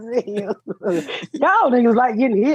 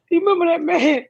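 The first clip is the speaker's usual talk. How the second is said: normal talking voice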